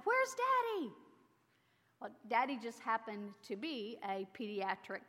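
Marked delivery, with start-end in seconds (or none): 0.0-0.9 put-on high, bright child's question; excited child. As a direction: ask like an eager little girl. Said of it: "she asked with excitement".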